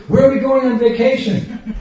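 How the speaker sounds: put-on high, loud excited kids asking; eager kids. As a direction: play the eager children in the back seat. Kids asking where they go.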